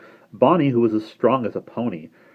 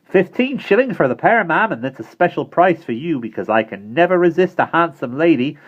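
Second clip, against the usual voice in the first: high, loud cheeky flattery; impudent; 10 semitones higher, 8 dB louder than usual. Acted. Simon flattering Missus Brisket to raise the price, impudent. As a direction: sell it with a cheeky grin. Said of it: "said Simon impudently".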